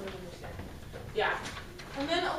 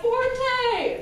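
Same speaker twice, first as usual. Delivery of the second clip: loud forte; 10 semitones higher, 6 dB louder than usual. Shown as a demonstration. Band teacher showing students how loud forte is, loud. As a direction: call out forte, high and loud. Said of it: "let's give it a forte".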